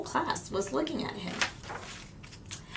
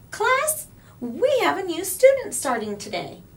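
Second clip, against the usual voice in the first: cheery teacher; 11 semitones higher, 4 dB louder than usual. A narrator's reading. Teacher addressing class.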